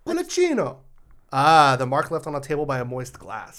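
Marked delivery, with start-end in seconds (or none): 0.1-0.8 culaccino sung out high in a hammed-up Italian accent, hand bunched; mock Italian. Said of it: "this helps with the pronunciation".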